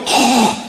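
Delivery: mock deer snort; imitated deer noise. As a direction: imitate a deer's noisy huff. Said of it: "they make a noise".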